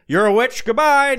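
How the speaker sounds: high, loud, dismissive jury; mock hasty verdict. Acts jury hastily condemning a witch.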